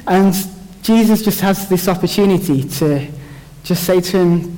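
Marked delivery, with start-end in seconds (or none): none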